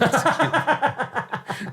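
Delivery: high, slow laughing mock outrage. Shown as a demonstration. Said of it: "Oh, they should have".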